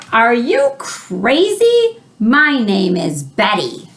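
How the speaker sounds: slow, high, scolding monster voice; hammed-up affronted Yeti; indignant monster. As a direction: scold the boy as an offended monster. Monster Betty angrily answers George.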